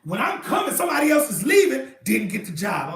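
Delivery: normal talking voice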